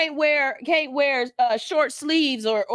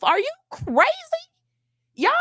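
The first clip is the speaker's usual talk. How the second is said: ordinary talk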